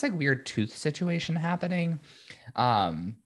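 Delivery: ordinary talk, nothing marked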